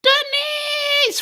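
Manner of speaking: high, loud shrill call. Retold or imitated. Bruce's chat reply read as a high, loud call to Denise. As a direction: shout her name up high. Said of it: "from Bruce".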